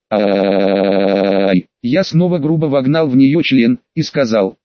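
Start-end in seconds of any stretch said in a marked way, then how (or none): 0.1-1.7 long, low wavering wail; acted drawn-out wail, pitched low; exclaimed cry. A narrator's reading. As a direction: draw the cry out slowly. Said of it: "Ирина воскликнула".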